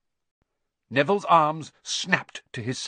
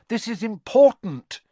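high, loud and emphatic; urgent insistence; 10 semitones higher, 8 dB louder than usual. A narrator's reading. Harry arguing with Neville, urgent insistence. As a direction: press the point urgently.

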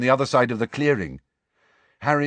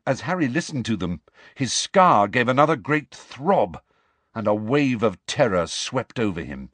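usual speaking voice

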